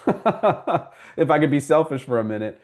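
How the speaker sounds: amused, laughing self-promotion. Real, not acted. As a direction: joke at your own plug with a real laugh. Jokes about recommending herself.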